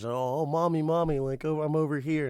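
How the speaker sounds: imitating a child calling for mommy; little daughter. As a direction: call out lightly like a small girl. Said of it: "she hears her daughter downstairs".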